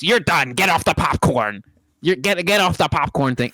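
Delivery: a hammed-up boss barking orders; imitating the boss. Boss kicking a worker off the popcorn machine, mock bossy. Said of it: "he does this little thing".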